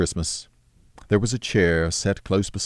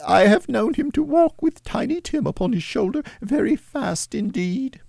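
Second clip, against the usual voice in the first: high mother's voice, steady with one falter; steady cheerful voice. Acted. Mother bravely speaks of Tiny Tim, steady then faltering. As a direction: keep cheerful though it falters once. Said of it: "in a steady, cheerful voice that only faltered once".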